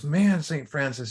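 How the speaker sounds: lightly acted wide-eyed wonder; amazed little boy. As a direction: marvel slowly, as an impressed kid would. Boy amazed at huge garden.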